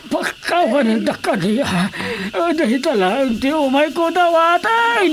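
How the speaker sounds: high, strained plea; pleading through tears; tearful pleading. Acted. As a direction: beg for forgiveness. Pleading for forgiveness.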